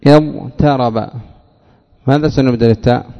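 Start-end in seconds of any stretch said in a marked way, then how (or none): 0.1-1.1 اضطرب said slowly, ض and ط both heavy; slow demo word. Shown as a demonstration. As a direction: draw out اضطرب carefully. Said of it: "الضاء".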